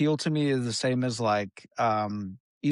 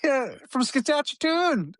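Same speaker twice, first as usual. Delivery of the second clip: mock goofy voice; 12 semitones higher than usual. Mocking the buff guy voice.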